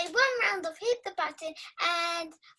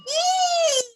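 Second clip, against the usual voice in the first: squealing, laughing cheer, much higher and louder; a burst of pure joy; real delighted cheer; 14 semitones higher, 8 dB louder than usual. Real, not acted. Girl cheers her times-table score to tutor, squealing with real joy.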